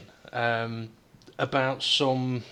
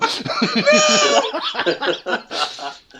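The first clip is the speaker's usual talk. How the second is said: high laugh; 6 dB louder than usual. Real, not acted. Laughing at own sneaky pick.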